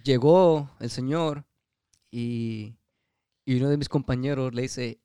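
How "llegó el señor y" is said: ordinary talk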